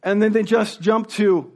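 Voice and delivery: ordinary talk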